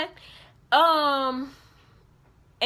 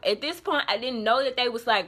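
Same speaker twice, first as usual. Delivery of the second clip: usual speaking voice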